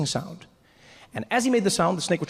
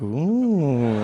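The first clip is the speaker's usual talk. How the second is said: long swooping ooh; mock-impressed drawn-out ooh; awed ooh; 4 dB louder than usual. Acted awed reaction to the charmer.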